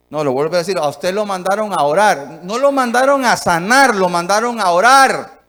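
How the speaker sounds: rising to loud, emphatic preaching; forceful preaching. Acted. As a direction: drive the point home to the congregation. Preacher hammers the point home.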